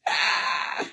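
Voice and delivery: ordinary talk, nothing marked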